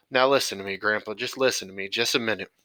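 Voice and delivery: usual speaking voice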